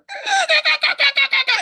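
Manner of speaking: very high, loud stuttering truck noise; rapid pulsing vocal sound effect of a juddering truck; imitated truck shudder. Game master shows the freightliner's cab shaking, imitated judder.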